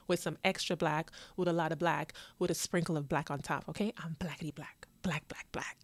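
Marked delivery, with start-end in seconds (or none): 4.0-5.8 softer whispered aside, breathy fun; playful whisper. Real, not acted. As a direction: lean in and whisper it.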